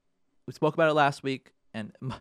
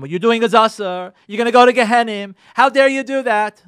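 loud, harsh rebuke of sinners; mock scolding; 5 semitones higher, 11 dB louder than usual. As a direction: blast them with a loud scolding. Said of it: "slamming them and telling them".

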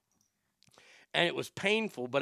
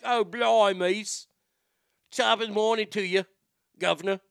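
higher, hammed-up British accent; 5 semitones higher, 3 dB louder than usual. Shown as a demonstration. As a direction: do a cheery cartoon British greeting. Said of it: "when I do my British impersonations".

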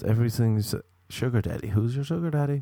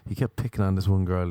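ordinary talk, nothing marked

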